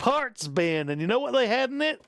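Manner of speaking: hammed-up Southern old-timer storyteller. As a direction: spin a yarn in mock Southern. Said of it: "ridiculous stereotype Southern accent there".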